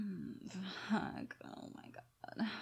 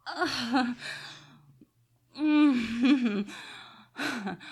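loud, high, breathless cries; loud moaning climax; 8 semitones higher, 16 dB louder, slower than usual. Real, not acted. Reaching climax.

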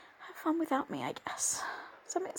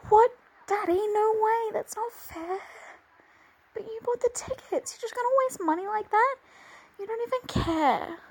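upset protest; 4 semitones higher, 7 dB louder than usual. Acted. Protesting cancelled plans.

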